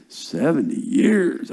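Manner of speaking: slow, stretched SEVENTY YEARS; drawn-out disbelief. Real, not acted. Preacher marvelling at the length of exile, drawn-out disbelief. Dwelling on how long it is.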